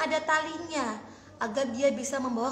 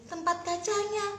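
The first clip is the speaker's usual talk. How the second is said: plain reading voice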